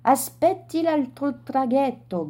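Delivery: sailor calling out. A narrator's reading. Sailor calling to the man left behind, mild shout. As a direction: call it across the water from the boat. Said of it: "gridò un marinaio".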